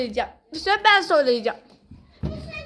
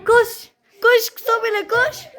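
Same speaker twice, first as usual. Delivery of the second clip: excited; 7 semitones higher, 3 dB louder, slower than usual. Real, not acted. Running to base in game.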